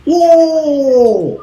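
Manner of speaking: exclamación aguda de asombro. Acted. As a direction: suelta un uh asombrado. Asombro del narrador. (uh agudo de asombro)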